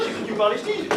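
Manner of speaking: ordinary talk, nothing marked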